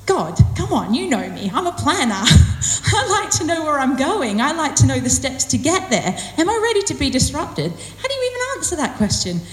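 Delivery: mock-indignant retort to an unsettling question; protesting to God. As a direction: plead your case, pitch climbing with each objection. Acting her protest back to God.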